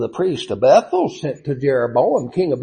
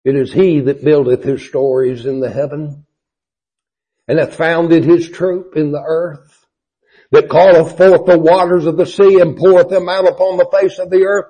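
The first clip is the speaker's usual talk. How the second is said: eloquent proclamation; 4 semitones higher, 5 dB louder than usual. Acted. Preacher proclaiming Amos's hymn, rising and grand. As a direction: lift it into soaring praise. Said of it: "he breaks out again in this eloquence".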